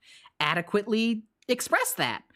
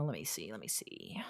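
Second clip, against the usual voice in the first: muttered to self; 11 semitones lower, 14 dB quieter than usual. Real, not acted. Muttering while reading chat.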